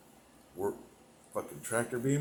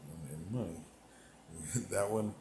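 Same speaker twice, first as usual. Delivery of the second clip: ordinary delivery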